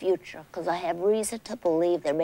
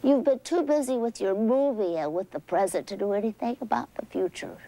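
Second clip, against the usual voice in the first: ordinary talk, nothing marked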